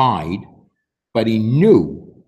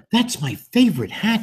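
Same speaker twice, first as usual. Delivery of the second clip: upset teacher; 12 semitones higher than usual. Acted. Miss Kirby upset hat blew.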